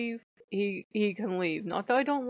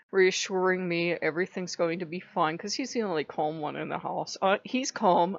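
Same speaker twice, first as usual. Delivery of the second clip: ordinary delivery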